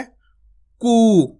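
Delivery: कु said as a long drawn-out koo with a loud long vowel; demonstrating the long vowel on कु; long stretched koo. Shown as a demonstration. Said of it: "खींच के उच्चारण हो रहा है".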